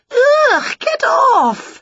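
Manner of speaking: very high, loud squeal of disgust; disgusted protest. A narrator's reading. Auntie wipes Winnie's face with spit, high disgusted squeal. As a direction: squeal it, pushing her away.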